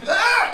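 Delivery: sharp, very high yelp of pain; high pained cry. Retold or imitated. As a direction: cry out and collapse. Pain cry before passing out.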